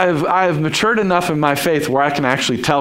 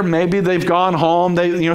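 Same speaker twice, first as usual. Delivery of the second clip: ordinary talk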